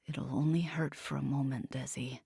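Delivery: soft, low, hollow voice; empty, resigned. Acted. Bay resigned to hurting Desi, soft and hollow. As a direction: say it like he's already given up. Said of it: "His voice was empty, broken, resigned".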